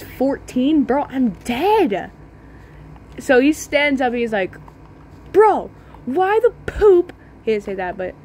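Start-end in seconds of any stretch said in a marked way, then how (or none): 5.3-7.1 high, loud mock outrage from a bigger kid; angry older kid. Big kid yelling at the boy who pushed him, in a high mock squeal. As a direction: squeak it out like an indignant teen.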